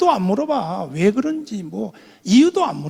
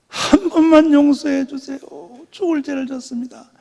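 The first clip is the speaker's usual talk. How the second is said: pleading; 6 semitones higher, 4 dB louder than usual. Retold or imitated. Kneeling, weeping plea to pastor.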